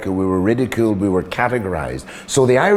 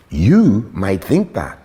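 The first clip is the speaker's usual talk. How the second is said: usual speaking voice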